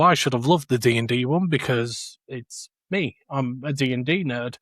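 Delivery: ordinary delivery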